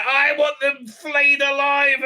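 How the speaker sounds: high, slow, loud demand; furious queen. Retold or imitated. Queen mother demands punishment for her son, hammed-up shout. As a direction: spit out the order with mock fury. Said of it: "and she's like".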